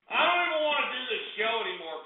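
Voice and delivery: ordinary delivery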